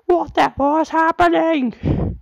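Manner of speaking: high, loud, shocked truck voice; a panicked little truck crying out; high squeal of confused trucks. A narrator's reading. Trucks crashing together.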